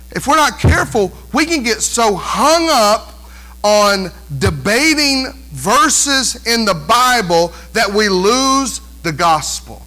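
normal talking voice

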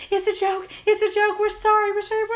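high, pleading mock panic; frantic apology. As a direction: imitate a wife begging pardon in a rush. Wife frantically apologising in story.

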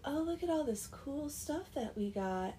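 high-pitched mean-girl put-on. Mean girls showing off their stuff, mean-girl act. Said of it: "doing the mean girl act".

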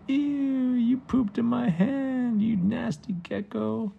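high, loud, drawn-out revulsion; slow, high squeal of disgust. Acted. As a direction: wail it like a grossed-out kid. Boy pooped on by gecko.

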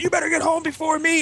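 very high-pitched yelled warning; hammed-up parental ultimatum; mock dad's threat. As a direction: imitate dad threatening his kid. Dad threatening running child.

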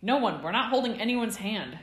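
loud, high protest; exasperated yell. A narrator's reading. Remus refusing the hand-holding, yelling. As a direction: shout it down in exasperation. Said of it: "Remus yelled".

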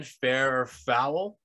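slow, drawn-out segment title; announcer voice. Acted. Host introducing the new segment, slow announcer voice. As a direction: announce the segment like a game show.

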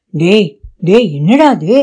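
startled, urgent call of his name; startled older brother; alarmed cry. A narrator's reading. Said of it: "என்று பதறினான்".

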